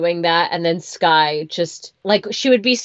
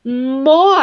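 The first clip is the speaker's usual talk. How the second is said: high, louder praise; praising therapist; 8 semitones higher, 7 dB louder than usual. Retold or imitated. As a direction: cheer a toddler on. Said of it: "And she would go".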